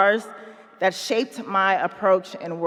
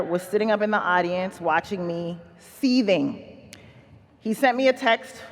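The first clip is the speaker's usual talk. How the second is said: ordinary delivery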